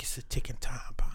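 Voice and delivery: hushed whisper of a warning. Retold or imitated. Whispering a warning to friends on the sideline. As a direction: whisper it like a secret alarm. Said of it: "and I be like".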